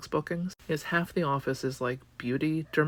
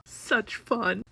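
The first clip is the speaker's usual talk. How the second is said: sarcastic; 7 semitones higher than usual. Real, not acted. Sarcastic aside.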